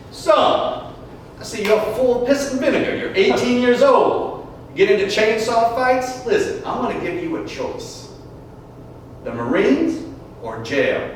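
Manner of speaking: lightly voiced stern old judge; a hint of a gruff Marine judge laying down the law. Judge to teen in court, lightly voiced stern judge. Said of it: "he looked at my brother and said".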